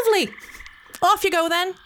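high, bright shooing; gleeful see-you-later; chirpy dismissal of the devil; cheerful send-off. A narrator's reading.

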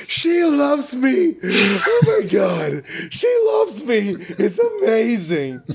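high, slow, giddy swoon with a laugh; hammed-up dreamy lovestruck god; lovestruck Thor. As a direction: float on the words like a dazed man in love. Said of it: "he's like".

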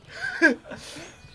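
bright burst of laughter; short real laugh. Real, not acted. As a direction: laugh out at the question. Laughs at question.

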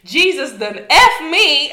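hammed-up exasperated curse, drawn out; mock anger. Storyteller acting out the winded man snapping at his lady, mock anger. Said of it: "He's, like, mad".

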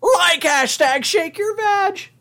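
high slow Shaggy voice. Pretend. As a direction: drawl it like Shaggy. Repeats phrase in cartoon voice.

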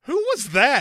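high, loud mock woman's voice; high put-on gal pal; Allison voice. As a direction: squeak it out curious.

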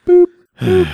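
loud, very high "boop"; short piercing toy-like boops; mock beep. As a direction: squeak it out like a machine beep. Imitating an electronic beep.

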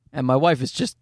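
ordinary talk

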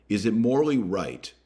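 ordinary delivery

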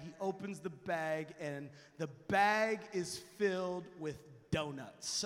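ordinary delivery

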